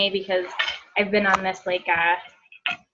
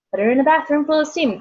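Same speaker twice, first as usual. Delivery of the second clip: lightly mocking, higher and quicker; mimicked advice; 7 semitones higher, 4 dB louder, faster than usual. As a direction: parrot the well-meant tip at a raised pitch. Mimics people giving unwanted advice.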